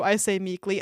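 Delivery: normal talking voice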